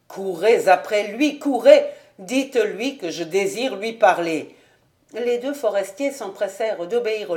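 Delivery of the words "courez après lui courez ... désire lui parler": louder, rising call after him; urgent shouted order. A narrator's reading. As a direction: command the woodsmen to chase him. Lord orders men to run.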